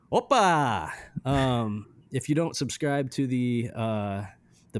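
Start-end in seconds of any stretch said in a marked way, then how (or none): 0.1-0.9 hammed-up Eastern European accent, rising louder and higher. Shown as a demonstration. Introducing a band from Bucharest, Eastern European accent. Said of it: "I get to do my Eastern European accent".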